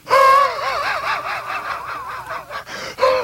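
hooting shout of laughter; big engine laughing. Acted. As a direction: let out a big mocking laugh. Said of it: "he started to laugh".

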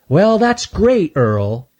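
high, slow sarcastic drawl; mock him slowly; sarcastic squirrel.

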